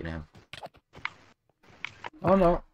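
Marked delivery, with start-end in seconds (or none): 2.2-2.6 high sudden oh no; startled squeak; alarmed. Real, not acted.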